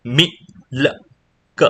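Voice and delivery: "મી, લ, ક" with a pause between sounds; "મી", "લ", "ક" as isolated sounds; sounded out. Shown as a demonstration. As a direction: sound "મી, લ, ક" out piece by piece. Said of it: "જોડાઈ જશે".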